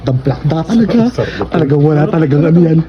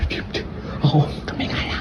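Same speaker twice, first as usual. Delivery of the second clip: whispering; 5 semitones lower, 12 dB quieter than usual. Real, not acted. Quiet so baby stays asleep.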